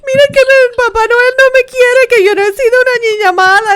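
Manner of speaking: high, loud tearful wail; mock crying. Niece retelling finding coal under the tree, mock crying. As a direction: imitate a bawling child's hammed-up cry. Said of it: "I was crying".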